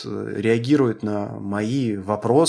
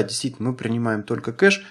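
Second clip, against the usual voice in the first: ordinary delivery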